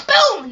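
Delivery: loud, high boom; explosive vocal sound effect; imitated impact. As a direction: punch out the jump scare.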